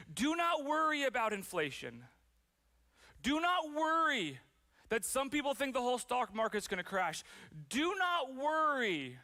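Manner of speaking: high, emphatic preacher's proclamation; preached proclamation. Acted. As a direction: proclaim it loudly, stretching the words out. Acting Jesus addressing today's congregation.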